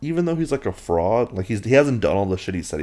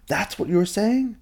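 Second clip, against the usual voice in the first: shocked friend; 13 semitones higher than usual. Retold or imitated. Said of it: "friend replies".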